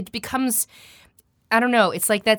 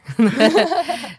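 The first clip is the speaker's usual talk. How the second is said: laugh; 9 semitones higher, 7 dB louder than usual. Real, not acted. Laughing at own demo.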